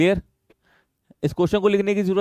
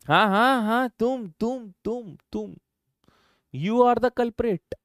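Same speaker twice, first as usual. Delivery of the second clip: high, slow mock-accusing; mock accusation; 6 semitones higher, slower than usual. Real, not acted. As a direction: point her out as the guilty one, teasing. Playfully accusing student. (हँसी-मज़ाक में छात्रा पर झूठा इल्ज़ाम)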